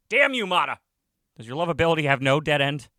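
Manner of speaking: loud, high cry of envy; exasperated outburst. A narrator's reading. Teacher inwardly cursing likable rival Mata, exasperated.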